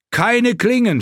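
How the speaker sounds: very high, loud yell; shouted warning. A narrator's reading. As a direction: scream to stop the men. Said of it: "schrie er". (gellend lauter Warnruf)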